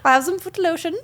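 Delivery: high-pitched, Swedish-sounding accent attempt; badly imitated accent, on the edge of laughter; botched film accent. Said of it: "Swedish accent".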